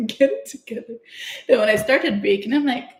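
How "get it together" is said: higher, laughing repeat of a mock reprimand; lighter, tickled pep-talk; amused self-scolding. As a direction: tell yourself off through a giggle.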